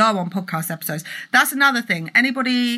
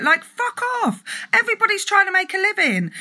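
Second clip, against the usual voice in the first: much higher, fast indignant swear; exasperated outburst; 10 semitones higher than usual. Real, not acted. Vlogger to online bullies, exasperated swearing outburst. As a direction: let the frustration burst out.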